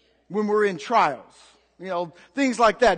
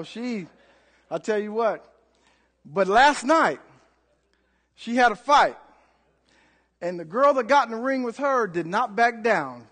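ordinary talk, nothing marked

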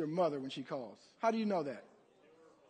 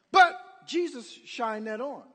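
ordinary delivery

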